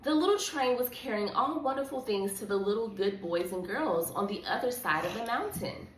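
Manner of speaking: ordinary delivery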